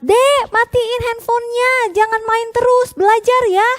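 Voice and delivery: high, loud mother calling through the door; loud mom calling. Retold or imitated. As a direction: call out like a mom stopping the game. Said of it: "suaranya kenceng banget". (suara mama memanggil keras dan tinggi)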